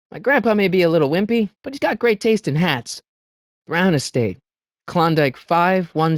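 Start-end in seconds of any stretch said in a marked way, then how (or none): none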